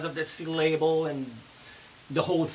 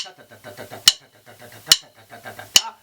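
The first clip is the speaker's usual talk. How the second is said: sung drum rhythm; 7 semitones lower, much faster than usual. Shown as a demonstration. Drum teacher singing the six-note rhythm with "ta" syllables. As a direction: sing the rhythm as even "ta" syllables. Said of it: "vocalizing the drum rhythm".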